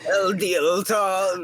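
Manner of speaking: El Diaton slow, high and grand, Spanish L; Spanish-style El Diaton. Pretend. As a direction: draw out El Diaton like a desert hero. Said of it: "plain old English L".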